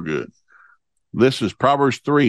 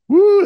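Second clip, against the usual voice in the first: high, loud "woo"; excited whoop; 8 dB louder than usual. Real, not acted. Preacher cheering the thought of children fearing God, high whoop. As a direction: let out a high sustained whoop. Exclaims over a prayer.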